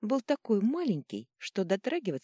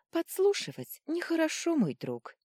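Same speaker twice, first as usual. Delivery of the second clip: high teasing reproof; amused, lightly mocking scolding; smirking; 13 semitones higher, 3 dB louder than usual. A narrator's reading. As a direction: chide with a smirk in the voice. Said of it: "усмехнулась принцесса".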